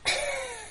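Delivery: sudden high gasp of shock; sharp gasp. Real, not acted. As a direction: gasp in surprise. Reacting to first crying story.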